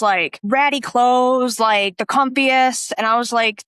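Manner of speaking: normal talking voice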